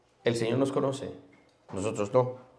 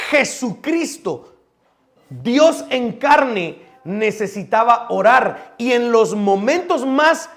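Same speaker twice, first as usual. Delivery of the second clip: preaching at full force; 7 semitones higher, 8 dB louder than usual. Real, not acted. Preacher urges his congregation that even Jesus needed prayer, full-force preaching. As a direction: hammer each phrase home from the pulpit.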